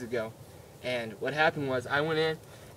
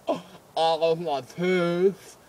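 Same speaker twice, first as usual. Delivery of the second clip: slow despairing moan; slower than usual. Retold or imitated. Patient dreading tool.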